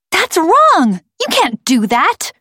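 put-on high, loud girl's outcry; high-pitched indignant cry; crying out in protest. A narrator's reading. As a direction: protest like an outraged child. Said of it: "cried Thulium".

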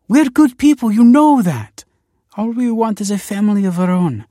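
high, loud, desperate begging; sobbing plea. A narrator's reading. Desperate wife begging Kelsey for a baby, sobbing. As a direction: plead at a high pitch, as if sobbing. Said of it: "she sobbed".

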